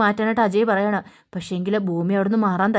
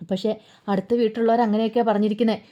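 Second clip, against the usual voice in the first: plain reading voice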